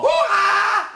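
hoch und laut, lachend; Hurra-Schrei. Acted. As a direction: jubel es laut heraus. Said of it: "du musst jetzt Hurra schreien". (lachender lauter Jubelschrei)

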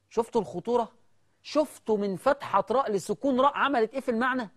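ordinary delivery

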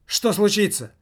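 high, loud panicked question; scared employee shouting out; frightened shout. Acted. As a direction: cry out in alarm. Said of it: "выкрикнул один из присутствовавших".

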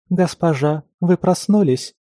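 lightly voiced, polite young maid; high sweet maid voice. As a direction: ask sweetly in a high girlish voice. Stranger girl voice.

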